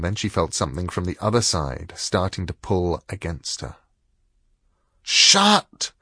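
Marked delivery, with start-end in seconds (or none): 5.0-5.9 fierce inner shout. A narrator's reading. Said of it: "she thought".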